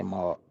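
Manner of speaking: "Mark" as mɔ, no r, low and quiet; Southern Mark. Shown as a demonstration. Streamer repeats the Southern way of saying Mark. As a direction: drop the r in "Mark". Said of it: "We say Mark".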